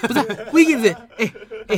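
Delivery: protesting through laughter; laughing protest. Real, not acted. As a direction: laugh while objecting. Laughing defence of Wiggins.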